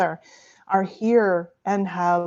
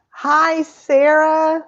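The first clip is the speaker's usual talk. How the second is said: high, loud, held-out hello; real delighted pitched-up greeting; excited greeting; 8 semitones higher, 6 dB louder, much slower than usual. Real, not acted. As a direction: call out to a friend just spotted. Spots another guest arriving.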